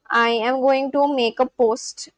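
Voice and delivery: normal talking voice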